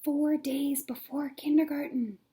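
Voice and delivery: unvoiced secret whisper; whispered heading. A narrator's reading. Reading to kids, whispering the day heading.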